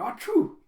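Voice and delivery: a loud comic sneeze. Retold or imitated. Boy sneezes after swim.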